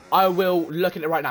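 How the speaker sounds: ordinary talk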